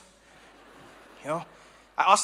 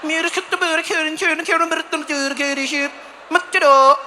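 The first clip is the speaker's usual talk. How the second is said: mock Swedish; 6 semitones higher, slower than usual. Comic mocking how Swedish sounds to him. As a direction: spit out fake Swedish syllables short and tight. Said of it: "It's just got that, like".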